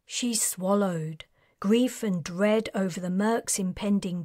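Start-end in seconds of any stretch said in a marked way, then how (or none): none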